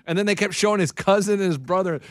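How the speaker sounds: ordinary talk, nothing marked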